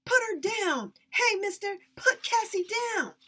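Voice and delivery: high, loud desperate yell; little brother yelling at the lumberman; shouting. Acted. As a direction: holler at the man to let her go. Boy defends his sister.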